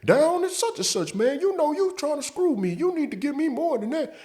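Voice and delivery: high falsetto whiny coworker voice; mocking coworker. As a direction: squeak it out like the nagging buddy. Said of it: "So he's like".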